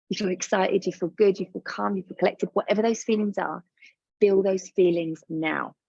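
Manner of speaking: ordinary delivery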